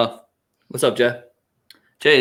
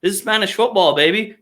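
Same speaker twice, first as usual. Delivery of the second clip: normal talking voice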